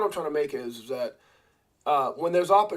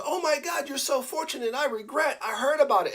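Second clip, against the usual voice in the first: mock envious friends; 8 semitones higher than usual.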